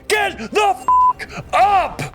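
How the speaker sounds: high, strained scream. Acted. Vanguard member to fallen comrade, screamed command. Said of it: "screams out".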